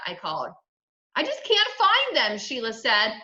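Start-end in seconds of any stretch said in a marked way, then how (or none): 1.2-2.4 put-on high, upset girl's wail; distressed complaint. A narrator's reading. Sheila hunting for the teeth, frustrated high cry. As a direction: give up in a high-pitched plaint.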